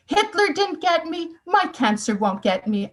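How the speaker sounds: retold defiance, pitched high at first; firm, emphatic retelling of a mother's defiance; defiant. Cancer patient telling her surgeon she won't give in, defiant. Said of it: "strength and attitude".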